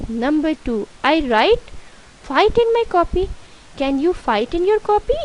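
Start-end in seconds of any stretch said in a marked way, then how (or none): 1.0-3.3 high-pitched, questioning reading. Shown as a demonstration. Teacher reads the faulty exercise sentence to pupils, questioning. 3.8-5.1 high-pitched rhetorical question. Shown as a demonstration. Teacher quizzes pupils on the faulty sentence, questioning.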